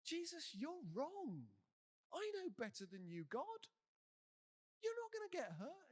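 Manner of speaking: high, swooping know-it-all contradiction; hammed-up correcting of someone who knows better; mock protest. Simon telling Jesus he won't die, mock protest.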